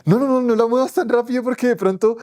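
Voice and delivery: high, hurried alarmed no-no-no; startled alarm. Retold or imitated. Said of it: "yo me asusto y digo como".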